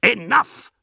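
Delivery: high, loud explosive shout; enraged roar. A narrator's reading. Captain silences the postulant, roaring. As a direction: roar to cut him off. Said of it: "Bel Ashered roared".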